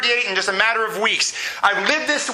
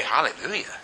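low, soft, questioning mumble; unenthusiastic congregation; 8 semitones lower, 6 dB quieter than usual. Retold or imitated. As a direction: say it small and puzzled, imitating a listless churchgoer. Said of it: "We sit here and say".

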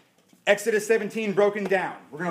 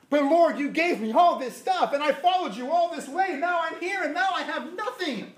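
frustrated believer; 7 semitones higher than usual. Acted. Believer complaining to the Lord after following him. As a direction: voice rising higher as the complaint builds, a laugh breaking in at the end.

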